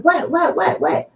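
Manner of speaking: high, rushed and eager; desperate to know what she found; eager urgency. Retold or imitated. Wife retelling how she begged Kelly to tell what she found, high eager voice.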